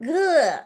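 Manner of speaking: high, loud "ugh" of being overtouched; overwhelmed groan. Acted. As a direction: groan out a fed-up recoil from touch. Acts out feeling overtouched.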